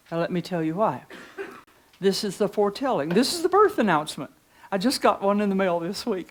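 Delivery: ordinary talk, nothing marked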